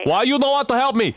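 put-on high-pitched accented protest; aggrieved complaint. Prank caller repeats his complaint.

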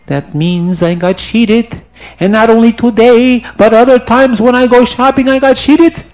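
building from dismay to a loud high outburst; growing anger. Acted. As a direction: let the anger climb line by line.

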